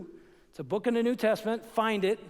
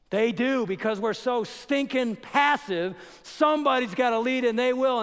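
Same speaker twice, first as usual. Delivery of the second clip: emphatic; 4 semitones higher, 6 dB louder than usual. Real, not acted. Pastor emphatically scolding passive men.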